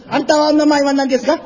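mock Japanese. Shown as a demonstration. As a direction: rattle it off like a stage Japanese speaker. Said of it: "就是".

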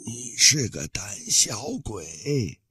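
slow, soft hissed insult; hissing. Acted. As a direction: hiss the words with contempt. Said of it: "大胡人嘶嘶地说".

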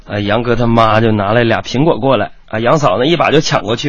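ordinary delivery

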